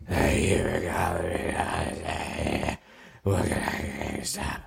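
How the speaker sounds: slow, low, strained croak through bronchitis; sick manager barely getting words out; barely able to talk. Retold or imitated. Croaking into the phone at work, too sick to talk.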